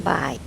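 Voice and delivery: บ่าย said with a low level tone. Shown as a demonstration. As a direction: say บ่าย low and flat, not like goodbye. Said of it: "but you make it low tone".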